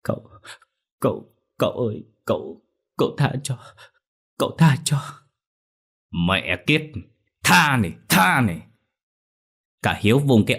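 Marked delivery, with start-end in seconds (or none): 0.0-5.0 frightened begging, rising higher at the end; trembling plea. Acted. Girl begs the master for mercy, trembling. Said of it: "run run mà lắc đầu". 6.3-8.6 furious. Acted. Master beats the mother.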